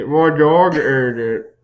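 slow, garbled words through a mouthful; mouth full. Acted. Student excusing a bitten homework, mouth full. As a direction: pretend to talk while chewing. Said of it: "I take a huge bite out of my homework".

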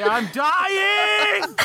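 high, drawn-out dramatic bellow; over-the-top cry for help; billowing roar. Acted. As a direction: roar it out as a hammed-up plea. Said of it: "billowing roar".

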